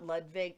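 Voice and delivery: Ludwig said "LOOD-vig", with a v sound; corrected pronunciation. Shown as a demonstration. Correcting co-host's German name, Ludwig with a v. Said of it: "It's pronounced Ludwig".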